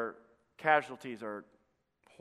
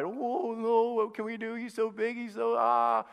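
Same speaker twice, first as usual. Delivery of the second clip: a hint of whiny, higher panic; mock fearful Christians; 7 semitones higher than usual. Preacher mocking timid Christians, lightly higher mock fear. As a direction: play the cowering believer, half-mocking.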